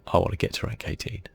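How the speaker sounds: ordinary talk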